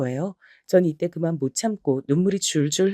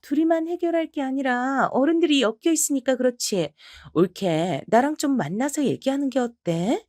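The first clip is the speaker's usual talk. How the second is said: higher, pushy in-law voice; a sister-in-law pressing her point; 6 semitones higher than usual. Acted. Sister-in-law confronting narrator. (높은 목소리로 몰아붙이는 시누이)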